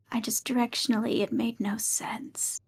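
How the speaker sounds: whispering. Acted. Character whispers in play.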